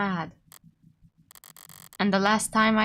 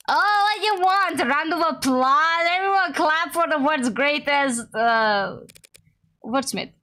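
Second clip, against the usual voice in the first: sarcastic mockery; 6 semitones higher than usual. Mocking partner's bad wordplay.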